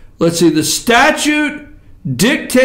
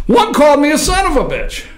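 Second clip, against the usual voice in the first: usual speaking voice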